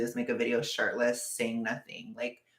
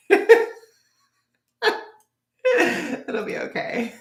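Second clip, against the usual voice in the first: high laugh breaking into the words; laughing reassurance; 11 semitones higher, 5 dB louder than usual. Real, not acted. Streamer telling viewers it will be fine, laughing. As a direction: reassure through helpless laughter.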